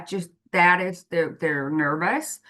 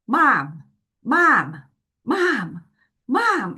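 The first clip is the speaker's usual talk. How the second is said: each Mom climbing higher, insistent; a kid pestering mom on the phone, each call higher; nagging child calls; 5 semitones higher, much slower than usual. Retold or imitated. Child calling for busy mom.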